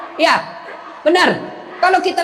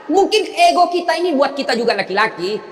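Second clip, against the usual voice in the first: ordinary talk, nothing marked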